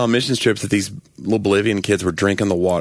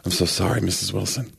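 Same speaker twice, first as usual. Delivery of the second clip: hushed, softer bad-news delivery; mock grave doctor; 6 dB quieter than usual. Doctor tells a mother the diagnosis, mock-solemn and soft. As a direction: break it gently, like a doctor with a grim diagnosis. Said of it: "if a doctor's ever said to you".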